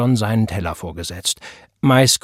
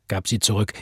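usual speaking voice

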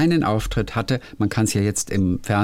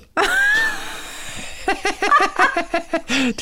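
high laugh. Real, not acted. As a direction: burst out laughing. Laughing at own punchline. (lautes, hohes Lachen)

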